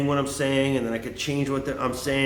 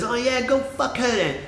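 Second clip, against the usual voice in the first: high mocking voice of the rejected guy; 10 semitones higher than usual. Imitating the rejected guy's retort, high and mocking. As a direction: sneer it up high. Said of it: "he says".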